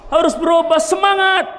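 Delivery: a preacher rallying the faithful with a shout; rousing shout. Real, not acted. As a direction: fire them up.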